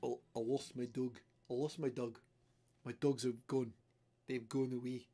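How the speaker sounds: a hint of an Australian accent; lightly attempted Australian twang on "dogs". Streamer retelling a vlogger's lost-dog video in a rough Australian accent. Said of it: "he's Australian".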